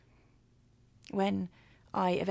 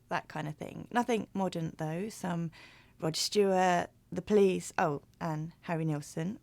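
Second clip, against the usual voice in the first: ordinary delivery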